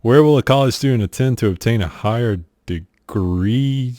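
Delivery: normal talking voice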